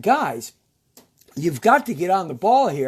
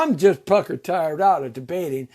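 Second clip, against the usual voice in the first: ordinary delivery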